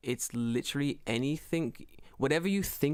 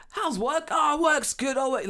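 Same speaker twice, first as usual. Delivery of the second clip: mock shouting match; 11 semitones higher, 5 dB louder, slower than usual. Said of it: "just screaming at this man".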